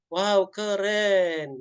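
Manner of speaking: high, drawn-out mock wonder, very slow; playful amazement at bad news; amazed wow. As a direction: stretch the wow like you're dazzled. Mentor amazed by friend's trouble.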